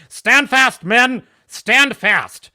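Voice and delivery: loud, high, drawn-out command; king's battle order. Acted. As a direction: bellow a hammed-up order to the troops. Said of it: "He says his next order to his men".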